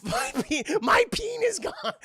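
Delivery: high, loud mock-agonized shriek; wailing wounded man. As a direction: wail in hammed-up pain over the loss. Wounded burglar lamenting his injury.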